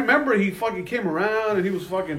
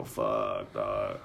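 much lower, soft, defeated curse; deflated groan; 12 dB quieter than usual. Retold or imitated. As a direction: let the air out, beaten. Resigned at the bribe demand.

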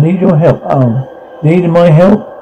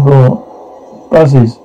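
usual speaking voice

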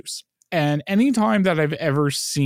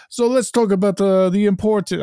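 hammed-up Greek accent, pitched higher; bad Greek accent; 6 semitones higher than usual. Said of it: "I'm gonna do a bad Greek accent".

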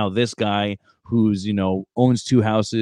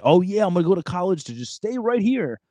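high, sarcastic fake cheer; mock the idea with fake enthusiasm; sarcastic mock excitement; 9 semitones higher than usual. Recruiter mocks seniors' plan to stay home, sarcastic high fake cheer.

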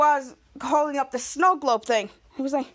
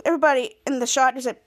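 plain reading voice

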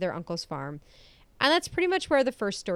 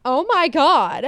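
slow, loud, higher-pitched disbelief; real astonishment; 5 semitones higher, 7 dB louder, slower than usual. Real, not acted. Host discovers doll birthday collection, astonished. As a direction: draw it out, thrilled and stunned.

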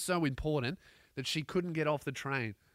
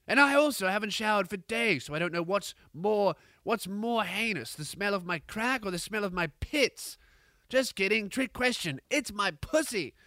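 higher, put-on English accent; hammed-up English accent, pitched up; 5 semitones higher than usual. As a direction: brag theatrically in a mock English accent. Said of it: "she's turned English".

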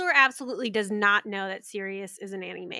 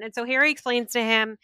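ordinary talk

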